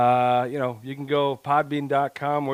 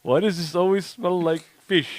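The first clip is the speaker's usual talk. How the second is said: mock Russian; 5 semitones higher than usual. Mock Russian cleaner bit.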